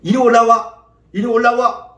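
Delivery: acted holler to come up; beckoning call. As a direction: call someone up from below. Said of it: "이렇게 해요?".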